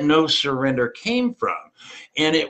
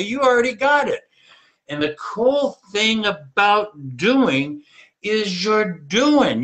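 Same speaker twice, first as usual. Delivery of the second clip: ordinary talk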